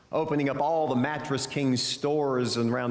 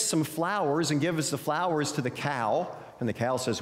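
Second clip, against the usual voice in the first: lively, higher-pitched storytelling; animated storyteller with swooping pitch; children's story narration; 5 semitones higher than usual. Acted. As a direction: read it like a picture book to young children, voicing the animals. Tells a children's story aloud.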